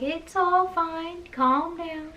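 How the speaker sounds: slow, high-pitched placating dad; dad impression. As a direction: imitate a father brushing off worry, drawn out. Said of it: "he was like".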